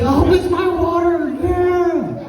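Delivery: put-on very high, drawn-out child squeal; delighted little girl. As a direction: imitate a kid thrilled by a present. Acts small daughter opening a gift.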